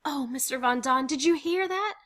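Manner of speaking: high, loud, jubilant; overjoyed. Acted. Mrs. Frank hugging Mister Von Don, overjoyed. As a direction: exclaim it in elation.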